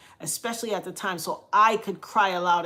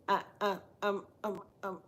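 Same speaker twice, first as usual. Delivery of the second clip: faint, halting attempts at words; soft, broken stammer, barely getting sound out; struggling to talk; 8 dB quieter than usual. Sick sister at the hospital trying to answer, faint and halting. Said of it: "She couldn't really talk".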